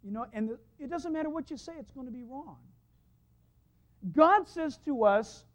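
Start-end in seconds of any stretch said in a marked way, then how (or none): none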